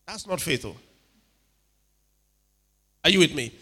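normal talking voice

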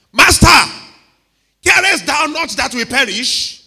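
high, loud panicked cry; frantic disciples shouting at their master; not nice, panicked. Acted. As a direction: wake him with an accusing cry. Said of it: "they were not very nice about it".

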